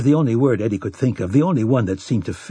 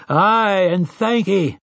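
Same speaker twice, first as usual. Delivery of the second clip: roared answer; 8 semitones higher, slower than usual. A narrator's reading. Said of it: "they had roared their answer".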